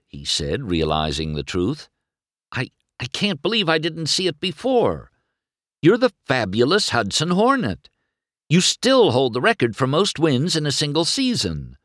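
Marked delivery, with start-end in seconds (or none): 2.5-11.8 high-pitched, thrilled fan; amazed, eager admiration; awestruck excitement. A narrator's reading. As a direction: gush with the voice held high. McQueen excited at realizing the truth.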